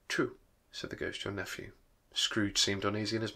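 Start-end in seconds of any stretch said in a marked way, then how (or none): 0.1-1.7 quiet ghost voice. A narrator's reading.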